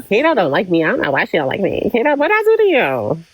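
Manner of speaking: normal talking voice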